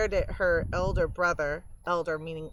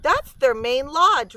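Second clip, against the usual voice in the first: with authority; 7 semitones higher, 8 dB louder than usual. Acted. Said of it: "said with authority".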